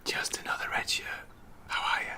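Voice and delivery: soft unvoiced whisper. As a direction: whisper it like a secret. Joining in whispering.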